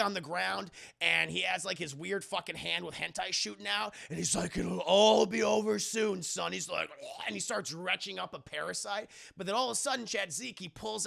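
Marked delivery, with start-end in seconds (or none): 4.6-6.5 slow, loud menacing villain. Retold or imitated. Villain over Zeke on the ground, slow menace. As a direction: draw it out loudly like a looming villain.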